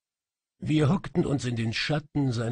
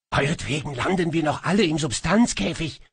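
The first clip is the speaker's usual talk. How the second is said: growling; 5 semitones higher, 6 dB louder, faster than usual. A narrator's reading. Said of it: "knurrte Tivok".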